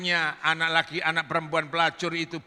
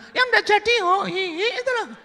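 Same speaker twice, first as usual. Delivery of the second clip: giggly hint in high falsetto; hint coyly in a very high voice; coy woman; 6 dB louder than usual. Acted. Woman hinting at their meeting, coy falsetto.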